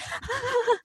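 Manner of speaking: soft, high imitated crying gasp; mock sob. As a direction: fake a breathy sob. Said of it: "she literally does this".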